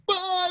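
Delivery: high, loud acted shriek; terrified scream. Jumper letting go of the wing, terrified scream. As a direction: scream as if just dropped. Acts screaming in free fall.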